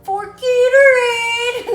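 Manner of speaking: slow, high, loud crying plea; movie quote voice. Acted. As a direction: wail it like the Waterboy. Waterboy quote.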